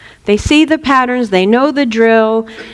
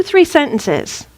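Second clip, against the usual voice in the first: ordinary talk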